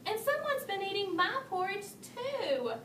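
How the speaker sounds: a hint of annoyance, understated; crossly. A narrator's reading. Mama Bear adding her complaint, mildly cross. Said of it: "added Mama Bear crossly".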